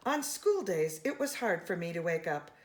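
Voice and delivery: ordinary delivery, nothing marked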